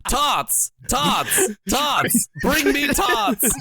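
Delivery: high, hammed-up shout for tots; mock yelling for tots; mock shouted demand. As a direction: bellow it like a greedy comic. Continuing the mock British bit.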